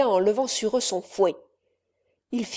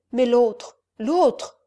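rising, louder frightened question; 2 semitones higher, 4 dB louder, much slower than usual. Acted. Haunted count asks about the apparition, fearful and rising. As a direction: grasp at the vision in dread.

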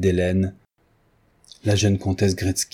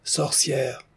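high but muted crowd echo; softer raised cry, falling at the end; mob repeating; 6 semitones higher, 4 dB quieter than usual. A narrator's reading. Advancing crowd repeats the accusation, crying out. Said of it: "La masse s'avança de deux pas en répétant".

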